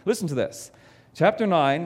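ordinary talk, nothing marked

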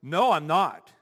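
firm, punchy retort; bold declaration. Retold or imitated. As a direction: declare it boldly, no backing down. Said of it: "She's bold and just declares".

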